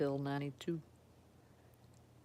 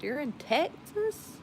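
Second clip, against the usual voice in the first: high, drawn-out disbelief; astonished, slowed right down; surprised question; 10 semitones higher, 4 dB louder, slower than usual. Real, not acted. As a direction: ask it slowly, pitch climbing in surprise. Reacting with surprise to the viewer's state.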